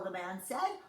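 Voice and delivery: usual speaking voice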